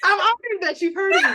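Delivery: amused, put-on awe; laughing mock awe. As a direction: play honored while cracking up. Mock awe amid shared laughter.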